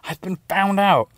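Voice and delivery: spiraling into panicked swearing; frantic panic. Retold or imitated. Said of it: "I'm frantically thinking".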